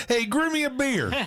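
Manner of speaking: imitating through laughter; laughing mock Scorpion line.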